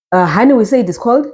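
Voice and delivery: ordinary talk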